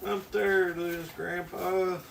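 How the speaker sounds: high, put-on whiny quote; whining tired kid. Baker with sore hand quotes kid, high mock whine.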